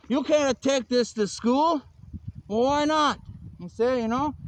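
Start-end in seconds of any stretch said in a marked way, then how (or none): 0.1-1.8 slow, higher-pitched mock protest; acted objection. Acted objection to carrying Bibles. 2.5-3.2 higher-pitched mock-puzzled retort; acted challenge.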